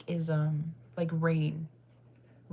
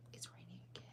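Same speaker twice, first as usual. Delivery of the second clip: whisper; 22 dB quieter, faster than usual. Acted. Rain, talking about ASMR.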